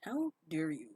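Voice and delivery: low, hushed indignation, hammed-up; mock aggressive. Joking with friends about getting revenge, mock aggressive. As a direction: play offended with quiet, low-pitched menace. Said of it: "Just get really aggressive".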